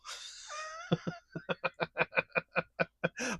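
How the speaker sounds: a sudden high-pitched laugh; high giggle. Real, not acted. Laughing at his own story about being fired. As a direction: laugh high and breathy at the memory.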